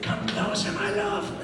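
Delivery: breathy whispered seductive jar; dying peanut butter jar. Retold or imitated. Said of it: "my impression of a dying peanut butter jar".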